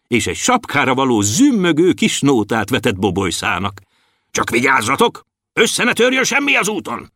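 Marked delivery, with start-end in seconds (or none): 4.3-7.1 warning that climbs high; cautioning. A narrator's reading. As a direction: warn them, pitch rising sharply. Storekeeper warns departing dwarfs. (magasba emelkedő figyelmeztetés)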